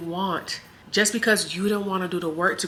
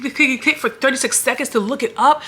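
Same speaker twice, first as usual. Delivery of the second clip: ordinary talk, nothing marked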